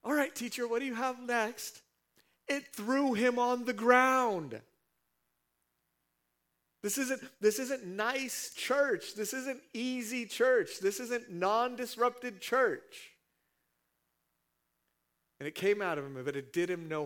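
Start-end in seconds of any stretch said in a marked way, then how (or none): 0.0-1.8 high, loud, easygoing relief; breezy man, lightly mocking; mock relieved man. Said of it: "the guy's like". 2.5-13.1 slow, loud, high preacher's cry; fiery preacher shouting; full-force preaching. Acted. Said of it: "preaching at full force".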